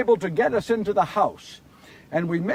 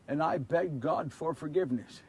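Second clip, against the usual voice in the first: much softer and lower, humbled; hushed, sincere; 5 semitones lower, 10 dB quieter than usual. A narrator's reading. Peter repeating his plea to the listeners: quiet, humbled. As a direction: say it quietly, as a confession.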